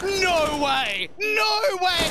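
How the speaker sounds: stunned, rising disbelief; shocked. Real, not acted. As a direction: cry out in disbelief. Co-caster reacts to clutch.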